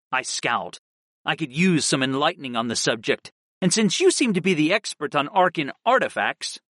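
usual speaking voice

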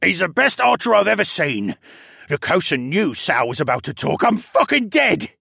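doomed captive in terror; panicked. A narrator's reading. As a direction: blurt it out, building to despair. Terrified prisoner expects to be shot.

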